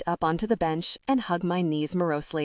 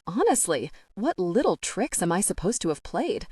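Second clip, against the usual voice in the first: usual speaking voice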